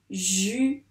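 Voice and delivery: "Jupe" split into ju, pause, pe; teacher counting out ju... pe; syllable by syllable. Shown as a demonstration. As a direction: say "Jupe" in two separate beats, first one rising. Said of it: "Il y a donc, donc deux syllabes".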